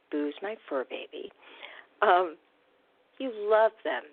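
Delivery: usual speaking voice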